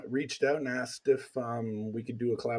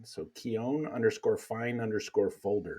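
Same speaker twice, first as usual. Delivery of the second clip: ordinary talk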